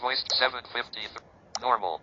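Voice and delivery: usual speaking voice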